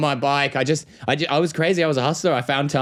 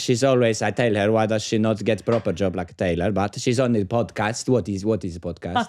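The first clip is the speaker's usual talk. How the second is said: lower, softer Greek grandmother complaining; Greek accent; 6 semitones lower, 3 dB quieter than usual. Acted. Doing a Greek mother for the friends, Greek accent. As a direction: grumble as a Greek mum about her daughter. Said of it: "Milo does a better Greek accent".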